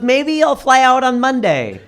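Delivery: hint at staying longer, slowly; mother-in-law voice. Retold or imitated. Mother-in-law to son-in-law in the snow, lightly voiced and slow. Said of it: "And she goes".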